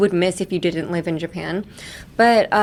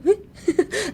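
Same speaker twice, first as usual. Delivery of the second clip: very high, short "eh?"; mock startled squeak of surprise; taken aback; 12 semitones higher than usual. Teacher shows reaction to being suddenly spoken to, startled. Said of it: "なんか急に言われて".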